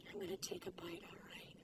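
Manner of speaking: soft unvoiced whisper. Real, not acted. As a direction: breathe it like a secret before the bite. About to taste the cookie.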